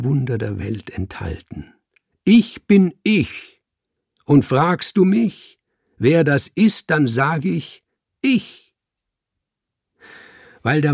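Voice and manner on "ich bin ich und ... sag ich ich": raised, ringing, emphatic voice; proud proclamation. A narrator's reading. Cat declaring who he is, proudly proclaiming. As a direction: proclaim it slowly and loudly.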